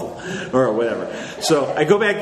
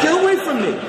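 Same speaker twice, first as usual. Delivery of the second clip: much higher panicked yelp with laughter; frantic newbie; 3 dB louder than usual. Retold or imitated. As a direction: flee the pest in mock panic.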